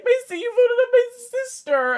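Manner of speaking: put-on high, loud whiny kid voice; a hammed-up wailing eleven-year-old; mock upset child. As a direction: squeal it like a hurt child. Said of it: "They're like".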